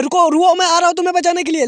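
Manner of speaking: high, hurried rescue call; urgent calling out. A narrator's reading. Hero rushing to save the falling girl, urgent high call. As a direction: call out quickly to someone in danger.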